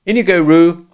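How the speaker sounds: high, loud Rabbit call. A narrator's reading. Rabbit hurrying Roo in as Kanga's head is turned, loud call. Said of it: "said in a loud voice".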